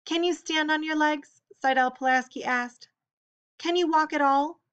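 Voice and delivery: bright, high-pitched eager questions. Acted. Sydelle eagerly asking Chris about his legs, high and keen. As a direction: pitch it up, a curious kid pressing. Eager kid quizzing wheelchair boy.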